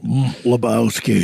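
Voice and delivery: low, slow cowboy drawl; low slow cowboy narrator; Sam Elliott narrator. Retold or imitated. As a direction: drop deep and slow like the old cowboy. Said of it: "the narrator guy at the bar that says".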